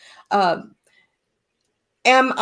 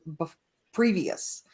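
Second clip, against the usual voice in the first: usual speaking voice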